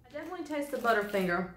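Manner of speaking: talking after swallowing; chewing food. Real, not acted. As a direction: mouth partly full. Seen 1: Bites bar at -5; closed-mouth chewing grimace until 2.8, then talks.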